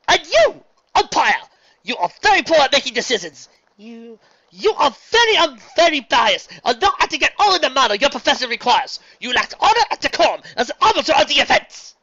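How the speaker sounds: high, loud stilted heckle; awkward shouting. Acted. Luna trying out heckling the pitcher, loud formal shout. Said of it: "better at shouting".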